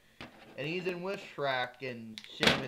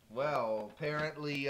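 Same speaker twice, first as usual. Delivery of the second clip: ordinary delivery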